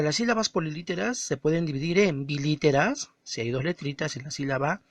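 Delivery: normal talking voice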